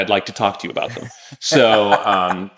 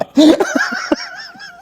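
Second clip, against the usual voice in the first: laughing. Real, not acted. Laughs about ex on Instagram.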